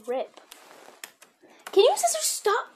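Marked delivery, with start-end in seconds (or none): 1.7-2.7 high, loud frustrated cry; exasperated outburst at scissors. Real, not acted.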